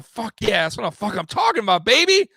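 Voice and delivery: put-on rising, loud happy whoop on baby; celebrating a win. Host imitating himself after a win, excited cheer. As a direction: hype it up like the game was just won. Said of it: "If I'm going".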